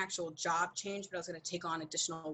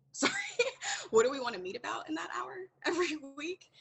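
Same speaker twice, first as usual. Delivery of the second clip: incredulous quote broken by a laugh; laughing disbelief; 9 semitones higher, 3 dB louder than usual. Retold or imitated. To the coworker, asking through laughter in disbelief. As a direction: laugh through the question as if it's absurd.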